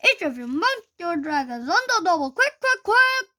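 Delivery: loud, rising, hurried commands; shouted orders. Acted. Teacher yelling at pupils to mount up quick. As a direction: hurry the pupils onto their dragons.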